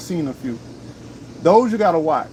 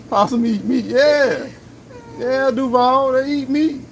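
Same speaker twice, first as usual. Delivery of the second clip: laughing; 9 semitones higher, 3 dB louder than usual. Real, not acted. Teasing question, laughing.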